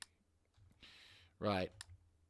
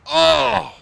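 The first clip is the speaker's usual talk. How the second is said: delighted gasp; 14 semitones higher, 18 dB louder than usual. Real, not acted. Artillery hits, delighted exclamation.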